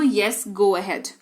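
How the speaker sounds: very slow, spelled-out English for practice; slow model sentence. Shown as a demonstration. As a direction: lay out every word slowly. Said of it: "आप yes भी बोल सकते हो". (धीमी गति में अभ्यास वाक्य)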